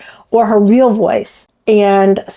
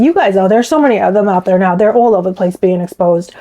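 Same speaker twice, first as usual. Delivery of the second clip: normal talking voice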